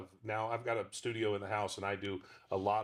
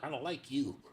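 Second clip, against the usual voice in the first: high, pinched dog with attitude; mock the man like a snotty dog; high squeezed puppet voice; 5 semitones higher than usual. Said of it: "can you do the voice".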